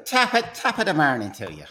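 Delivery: hammed-up Irish greeting; co-host playing a stage Irishman for the host; stage Irish accent. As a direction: lay the Irish on thick. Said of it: "It's, it's Irish".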